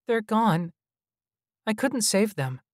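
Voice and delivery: put-on high, pained little-boy voice; pained child. A narrator's reading. Child hologram confessing it failed its people, pained and high. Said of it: "Oz said in a pained voice".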